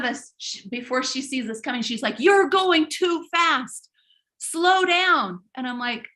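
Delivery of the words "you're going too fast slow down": slow, raised scolding warning; a hint of a bossy stranger scolding; bossy hiker lady. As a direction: tell them off slowly from up the trail.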